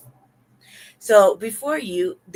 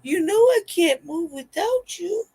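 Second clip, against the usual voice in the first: high, slow tearful plea; hammed-up lovesick wail; mock crying; 9 semitones higher than usual. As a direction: draw it out in a put-on weepy voice. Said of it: "crying, like".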